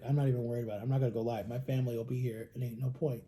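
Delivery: softly resigned, a touch sulky; resigned shrug. Retold or imitated. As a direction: let it go, deflated. Giving up after ban.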